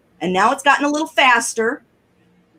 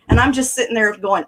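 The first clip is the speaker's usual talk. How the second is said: ordinary delivery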